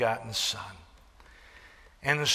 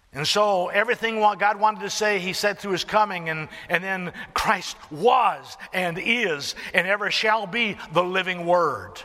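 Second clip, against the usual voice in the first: loud, high, ringing proclamation; full-force preaching; 6 semitones higher, 5 dB louder than usual. Real, not acted. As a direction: proclaim it to the back row.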